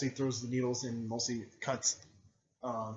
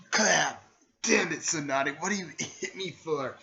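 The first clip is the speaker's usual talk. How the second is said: loud, raised-pitch indignant complaint; mock-angry protest; 5 semitones higher, 7 dB louder than usual. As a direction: play at protesting a sudden blow. Retold character protests being hit.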